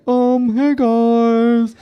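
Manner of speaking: high, slow awkward-guy voice; drawn out, awkward and high; Duncan voice. Retold or imitated. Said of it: "The, the Duncan character started".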